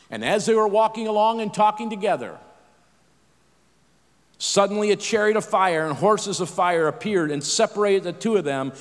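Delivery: ordinary talk, nothing marked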